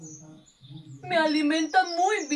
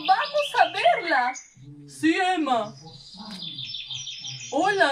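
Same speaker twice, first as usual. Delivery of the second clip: ordinary delivery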